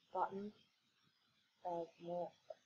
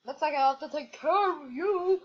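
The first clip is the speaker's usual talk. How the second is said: louder, higher menacing boss; villain voice; 5 semitones higher, 6 dB louder than usual. Pretend. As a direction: gloat like a villain guarding treasure. Boss threatens the player.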